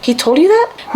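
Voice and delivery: shocked high question; he can't believe what he's hearing; surprised. Retold or imitated. Husband's shocked reply retold.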